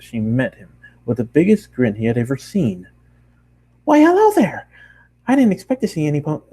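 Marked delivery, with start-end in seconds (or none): 3.8-4.7 much higher, louder breathy squeal of a greeting; bubbly pony girl. Acted. Bakery girl spinning round to greet the traveler, squealing high. 5.3-6.4 higher, fast chatter; excited pony girl. Acted. Bakery girl surprised to see a customer, fast and high.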